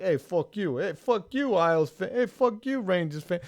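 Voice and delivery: put-on heavy New York accent, shouting; brash Queens trash talk, pitch climbing. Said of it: "in a very heavy New York accent".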